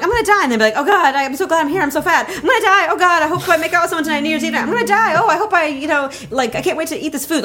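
high-pitched, racing mock panic; hammed-up dread tumbling into giddy asides; panicked inner thoughts. Voicing her panicked racing thoughts.

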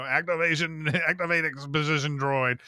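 lightly put-on droid voice. Shown as a demonstration. Actor re-creating his line for the host, droid voice. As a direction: say it as an announcing droid, with a chuckle. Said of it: "droid voice or whatever".

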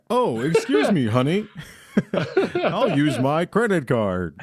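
slow, giggly quote through laughter; laughing through the credit-card line. Retold or imitated. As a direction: deliver it while cracking up, drawn out. Re-acting the episode line, laughing.

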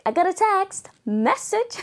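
high excited announcement; excited squeal. Acted. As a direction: squeal it like big news. Mock excited phone alert.

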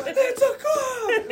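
high, slow, laughing yell; panicked shout. Retold or imitated. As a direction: cry the alarm to the group. Kids panicking at police car.

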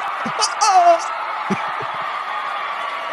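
high unvoiced shriek of delight; excited shout. Celebrating a crispy high five with a high squeal. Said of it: "and I'm like".

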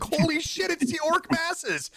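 high-pitched mock alarm with a laugh; retold panicked cry. Player retelling an orc ambush, hammed-up alarm. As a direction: cry it out high, stretching the end.

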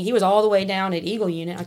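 ordinary talk

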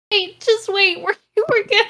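normal talking voice

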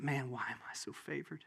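low, hushed amazement; humble wonder. Acted. Elizabeth marveling at Mary's visit, humbly hushed. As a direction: say it softly, almost whispering.

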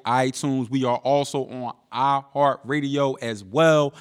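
laid-back host sign-off over music; podcast outro read. Acted. Host signing off to listeners, easy promo read. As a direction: plug the show like closing out an episode.

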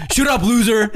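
laughing mock taunt; mock yell. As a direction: jeer it through laughter. Classmate mocking the class clown.

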